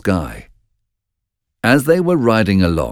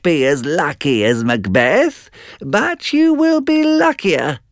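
witches prophesying; 9 semitones higher than usual. A narrator's reading. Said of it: "The witches answered in turn".

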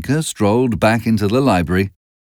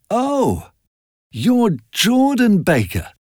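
higher, slow recognition; oh, it's you, drawn out in surprise; surprised exclamation; 12 semitones higher, much slower than usual. A narrator's reading. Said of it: "I exclaimed".

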